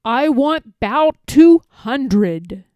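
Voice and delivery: a haughty king thundering at his subjects. A narrator's reading. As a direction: boom out the order from the throne. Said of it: "his royal voice thundered".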